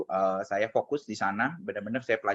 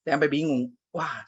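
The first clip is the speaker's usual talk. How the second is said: normal talking voice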